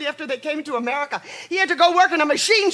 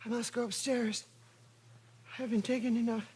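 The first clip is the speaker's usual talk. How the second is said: numb murmur; 8 dB quieter than usual. Acted. Mother alone, drugged murmuring.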